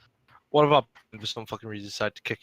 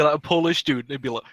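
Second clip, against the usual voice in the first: normal talking voice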